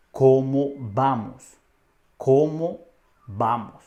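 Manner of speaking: "¿Cómo vamos?" slowly, one word at a time; slow model phrase. Shown as a demonstration. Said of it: "Repite después de mí". ("¿Cómo vamos?" despacio, palabra por palabra)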